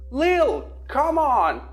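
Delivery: high loud despairing shout; desperate shout. A narrator's reading. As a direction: yell for her, desperate. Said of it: "shouted Kyle, on the verge of despair".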